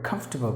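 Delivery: "Comfortable" as KUMF-ter-bul, three beats; comfortable three syllables. Shown as a demonstration. Teacher shows how to say "Comfortable". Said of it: "Perfect way".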